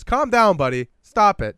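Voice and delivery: mock scolding. Diner telling show-off guest off, mock scolding. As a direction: scold him at volume.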